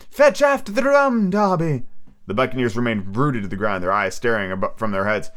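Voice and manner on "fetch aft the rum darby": high, louder, slow spectral cry; ghostly call rising higher. A narrator's reading. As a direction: let the phantom voice climb. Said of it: "raising a little higher with an oath".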